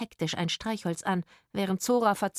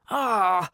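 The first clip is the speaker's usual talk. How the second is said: sudden loud groan of pain; pained cry; 2 semitones higher, 7 dB louder than usual. A narrator's reading. Man whose back gives out, pained groan. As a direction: cry out as your back seizes. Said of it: "sagte der Mann plötzlich zusammen".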